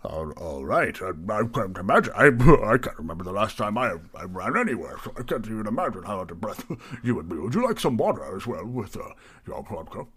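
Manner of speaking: fussy, lilting host patter; mildly flustered innkeeper being helpful; obliging tavern keeper. Acted. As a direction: accommodate the customer with nervous politeness. Plays Barry, flustered tavern keeper.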